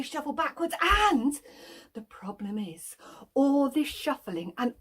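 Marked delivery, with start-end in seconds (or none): 1.9-3.0 suspenseful hushed aside; whisper. A narrator's reading. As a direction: breathe the warning. Suspense build.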